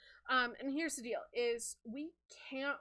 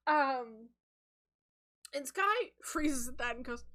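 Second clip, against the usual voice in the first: ordinary talk, nothing marked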